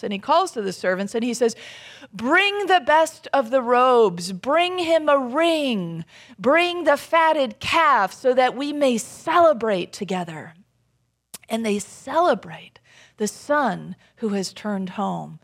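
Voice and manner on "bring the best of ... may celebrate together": loud, high, slow proclamation; jubilant command. Retold or imitated. Father calling servants to celebrate, jubilant command. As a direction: call it out grandly, stretching the words.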